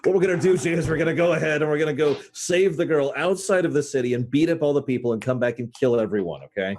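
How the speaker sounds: oversell the plan to Jay; hammed-up gung-ho plan. Character rallies Jay with a reckless plan, hammed-up. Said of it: "let me burn a save state".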